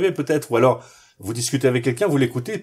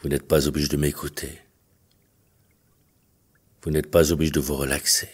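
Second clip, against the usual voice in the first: low, measured hypnotist's voice with long pauses; hypnosis induction; 7 semitones lower than usual. Acted. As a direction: guide them gently into trance, leaving space between phrases. Leads a hypnosis session.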